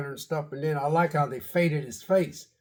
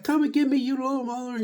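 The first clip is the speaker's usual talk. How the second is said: mock taunt; 10 semitones higher than usual. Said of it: "she was like".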